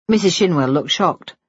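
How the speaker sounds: plain reading voice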